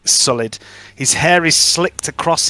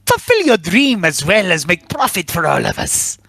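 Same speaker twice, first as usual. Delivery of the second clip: booming sing song welcome; 9 semitones higher than usual. Acted. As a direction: greet them like a grand showman. GM voices merchant NPC.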